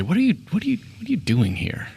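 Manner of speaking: eerie spider creature questioning. Acted. Glowing-eyed spider creature confronts the players, high and eerie. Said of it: "look right at you and say".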